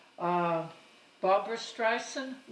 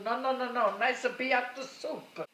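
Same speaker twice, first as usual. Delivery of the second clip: mother insisting; 6 semitones higher, 4 dB louder than usual. Retold or imitated. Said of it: "I said, "Ma, playpen fills you up too".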